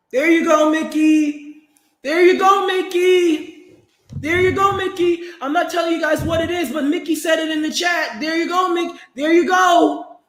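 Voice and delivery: high-pitched, excited call-out; emphatic approval. Real, not acted. Streamer applauding a viewer's chat comment, emphatically approving. Hailing chat member who said it.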